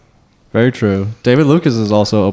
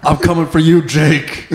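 wrestling promo; 4 semitones higher than usual. Pretend. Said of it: "Cut more promos".

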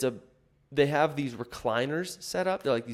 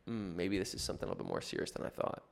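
quiet worried thought; 3 semitones lower, 9 dB quieter, faster than usual. Retold or imitated. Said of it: "of it being like".

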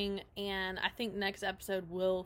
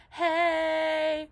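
high, loud, drawn-out hey; friendly greeting; 7 semitones higher, 7 dB louder than usual. Acted. Covey waving to the skateboarders, called-out greeting. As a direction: call out a long, raised hello. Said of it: "and she's just like".

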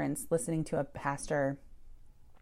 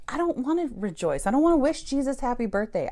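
raised, fast, petulant protest; higher, louder mock tantrum; angry inner voice; 7 semitones higher, 4 dB louder, faster than usual. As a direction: spit it out like a sulking kid who refuses. Mad at church, venting inner voice.

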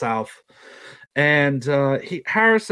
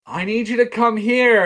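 urgent call; 11 semitones higher, 5 dB louder than usual. Retold or imitated. Brother calling from office.

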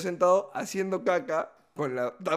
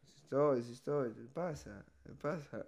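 drowsy mumble; 3 semitones lower, 11 dB quieter than usual. Retold or imitated. Sleepy son answering through door.

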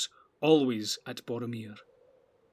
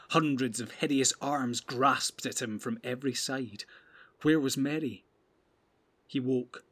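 usual speaking voice

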